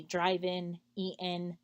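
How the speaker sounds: "drive-in, eat-in" ending in a plain N; dropped final G. Shown as a demonstration. Showing how Russian learners end ING words, G dropped. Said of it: "leave that G off at the end".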